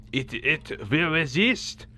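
bad French accent. Gamer mocking his own slip, bad French accent. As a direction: drag it out in a hammed-up French accent. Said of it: "with a very bad French accent".

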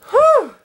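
big high relieved whew, sharply loud; exhale whew. Real, not acted. Worn out after a long day, loud high whew.

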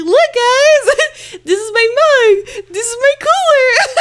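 high, giggly show-off voice; hammed-up high-pitched excitement; mock show-and-tell. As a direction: playfully present it to viewers, laughing. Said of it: "I'm gonna be like".

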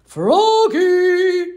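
loud, high call across the house; father calling his son loudly. Acted. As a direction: call him from far off. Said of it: "called his father".

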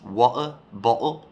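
Cockney "Water bottle" with a dropped t, "wa'er bo'le"; Cockney accent. Shown as a demonstration. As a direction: swallow the t in "bottle", Cockney style. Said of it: "will maybe do a Cockney accent".

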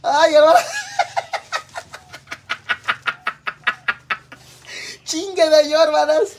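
high, loud cracking-up laughter; laughing hard. Real, not acted. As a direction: laugh till the voice breaks.

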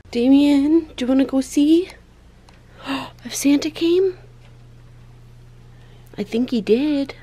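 excited. Real, not acted. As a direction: build the Christmas morning thrill. Waking child for presents.

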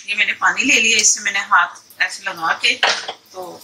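normal talking voice

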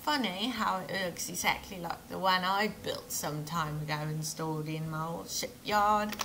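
inconsistent mock British-Australian accent; accent sliding from British to Australian. Shipbuilder recalling a grumbling customer, accent sliding from British to Australian. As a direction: wander from Pommy into Australian. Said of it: "I think I just went from, like, Pommy to Australia".

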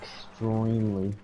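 slow, spaced-out answer; stoned drawl. Real, not acted. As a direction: drag it out, stoned. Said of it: "You're high right now".

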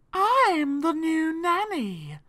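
high, slow, drawn-out spider voice; slow, high creature voice; spider nanny. A narrator's reading. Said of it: "said the spider".

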